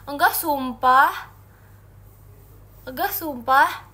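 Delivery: loud, slow insisting; playful loud protest; insistent. Real, not acted. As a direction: swear it loudly. Insisting to chat.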